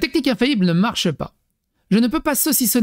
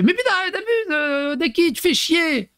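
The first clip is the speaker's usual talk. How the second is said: much higher, exasperated mock wife; 11 semitones higher than usual. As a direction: imitate the wife snapping at him, pitched up. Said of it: "elle fait". (la femme excédée, voix très aiguë)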